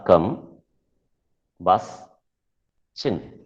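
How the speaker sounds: combustion broken into cum, bus, chin; sound by sound. Shown as a demonstration. Teacher splitting combustion into its three sounds, separated syllables. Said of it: "The first three, uh, sounds are".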